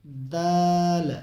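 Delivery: दाल stretched out slowly and clearly; drawn-out letter name. Shown as a demonstration. Teacher reciting दाल slowly for viewers. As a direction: draw out दाल for the class. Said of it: "teacher demonstrating the letter".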